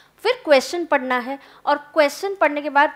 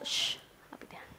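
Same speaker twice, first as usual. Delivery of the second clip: a sharp whispered shush to the class; hushing whisper; 17 dB quieter, faster than usual. Real, not acted. Teacher quiets the chattering students with a whispered shush. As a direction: hiss them quiet without voice.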